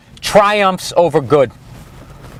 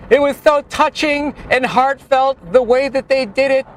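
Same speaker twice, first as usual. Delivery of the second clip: mock gushing critic; 9 semitones higher than usual. Mock gushing movie review.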